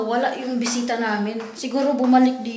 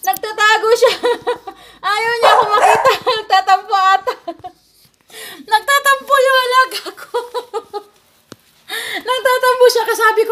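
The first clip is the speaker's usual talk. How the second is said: high, loud, laughing through the words; 7 semitones higher, 4 dB louder than usual. Real, not acted. Telling viewers her dog is sulking, laughing through it. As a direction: giggle while telling it.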